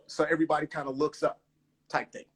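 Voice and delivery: normal talking voice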